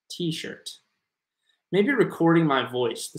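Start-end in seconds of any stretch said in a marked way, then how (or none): 0.1-0.7 "T-shirt" whispered almost without voice; a breath-only "T-shirt". Shown as a demonstration. Teacher whispering "T-shirt" for María. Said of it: "¿Cómo pronunciar t-shirt?".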